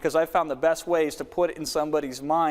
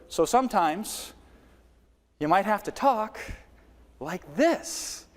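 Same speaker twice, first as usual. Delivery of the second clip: slow and drawn out, each word apart; slowed demo; 5 semitones higher, slower than usual. Shown as a demonstration. Coach slows right down to demo pacing. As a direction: stretch it out to show a change of pace. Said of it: "change up your delivery".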